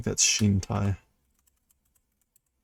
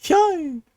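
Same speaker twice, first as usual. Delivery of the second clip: high startled squeak; tiny terrified squeak; scared yelp; 3 dB louder than usual. Retold or imitated. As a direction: yelp like a frightened girl. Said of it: "That's not a happy sound".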